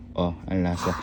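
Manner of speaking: low, quiet taster voice; deep taste voice. Pretend. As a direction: drop it low and soft. Tasting joke again.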